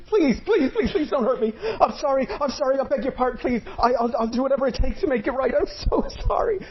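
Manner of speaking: high, frantic begging, half laughing; terrified pleading. Acted. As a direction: beg for mercy in a high panicked voice. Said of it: "He was cowering in the corner".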